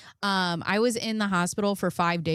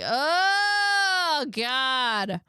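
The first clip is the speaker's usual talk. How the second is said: long, high cry falling away; mock wail; 9 semitones higher, 3 dB louder than usual. Patient embarrassed by nurses' help, mock high wail. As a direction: wail it in exaggerated mortification.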